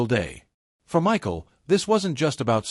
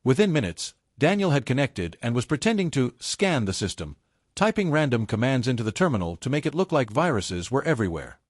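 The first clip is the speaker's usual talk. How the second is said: ordinary delivery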